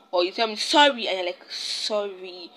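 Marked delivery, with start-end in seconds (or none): 0.6-1.1 "Sorry" jumps high and louder with a fall; "Sorry" tossed off sharply; curt sorry. Shown as a demonstration. Showing a rude apology, "Sorry" said curtly. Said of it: "it takes a different tone in the head". 1.5-2.5 "Sorry" softer and drawn out; "Sorry" said gently and slowly. Shown as a demonstration. Showing a kind apology, "Sorry" said softly. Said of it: "it takes a different tone in the head".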